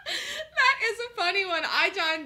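high, laughing through the words; cracking up at her own joke; giggly amused remark. Real, not acted.

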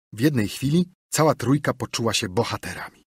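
usual speaking voice

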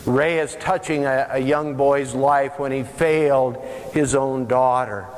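usual speaking voice

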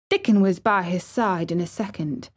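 usual speaking voice

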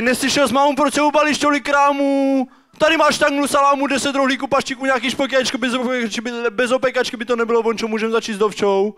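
high, exasperated dad tirade; ranting dad. Acted. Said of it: "detaily vám poví taťka".

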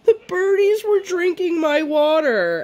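put-on high, slow whimpering little-girl voice; weepy toddler. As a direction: mimic her teary toddler voice.

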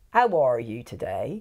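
broad Somerset drawl; Somerset accent. Shown as a demonstration. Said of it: "if you say in, in Somerset".

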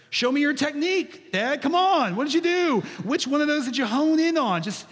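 put-on pleading kid. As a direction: beg dad like an eager little boy. Child begging dad for technique.

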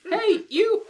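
high squeaky callout; squeaky old man yelling hey you; squeaky grizzled voice. Acted. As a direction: call in like a squeaky old grump. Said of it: "A squeaky yet grizzled voice called in".

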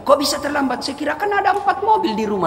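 high-pitched incredulous questioning; amazed maid. Acted. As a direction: question the master in disbelief. Maid amazed that master is late.

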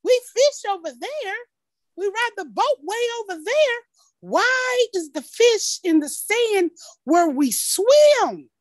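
lightly voiced kid, pleading for sense; worried child. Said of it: "I'm like".

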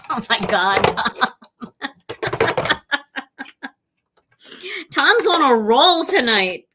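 laughing through the words; amused laugh at a joke. Real, not acted.

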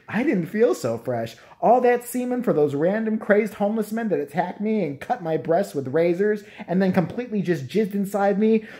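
usual speaking voice

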